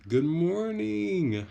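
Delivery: high, loud, drawn-out singsong hello; excited greeting. Real, not acted. Streamer greeting a viewer by chat, excited and effusive. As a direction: call it out with big, stretched-out delight.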